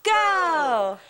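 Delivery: shouted cheer, leading the crowd; cheer call. Acted. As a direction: shout it to lead the room's cheer. Leading a call-and-response school cheer.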